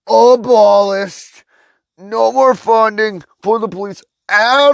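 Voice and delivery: high, slow, loud mock official announcement; hammed-up government spokesman voice; mock news announcement. Ranter mocks how the government would announce it, high and slow. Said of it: "gonna come on the news".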